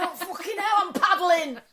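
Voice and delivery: loud, high-pitched mock distress; retold exasperation. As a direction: wail it in comic frustration. Exasperated, standing in leaking water.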